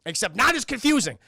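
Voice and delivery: ordinary delivery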